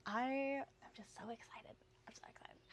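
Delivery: whispered, giddy confession; hushed and unvoiced, thrilled to share; excited whisper. Real, not acted. Confides her excitement in a whisper.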